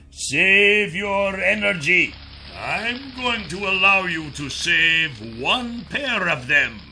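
sneering villain. Acted. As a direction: drawl out the taunt with contempt. Kryzak taunts.

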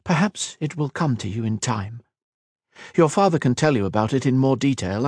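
reassuring elf. Acted. Kira telling Kat of father's rescue, reassuring elf. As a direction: console her while recounting.

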